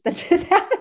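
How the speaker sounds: high, loud embarrassed laugh; laughing after a sneeze. Real, not acted. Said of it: "excuse my sneeze".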